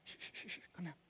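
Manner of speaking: very soft shushing, near whisper; acted hush with quiet, spaced-out pleas; hushing a crowd. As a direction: gently settle everyone down, barely voiced. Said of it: "gets everybody to calm down".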